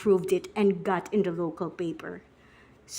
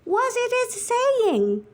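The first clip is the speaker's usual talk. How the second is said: much higher, drawn-out question; eager friend asking; 12 semitones higher, 4 dB louder, much slower than usual. Acted. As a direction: stretch it out high, playing the curious friend. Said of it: "My friend asked".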